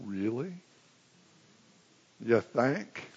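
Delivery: soft, wry sarcasm; sarcastic. Real, not acted. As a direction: tease the gospel writers. Mocking the understatement.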